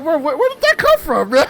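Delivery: high, loud bro where did that come from; stunned disbelief. Retold or imitated. Viewer reacting to the teacher's hidden size, stunned high disbelief. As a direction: gasp it out in disbelief.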